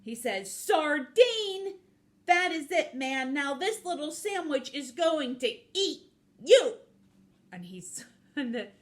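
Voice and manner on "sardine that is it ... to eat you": loud, high cartoon fury; hammed-up offended rant; indignant threat. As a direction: start high in outrage, easing down into a loud threat. Offended piranha threatens the guard.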